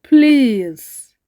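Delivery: usual speaking voice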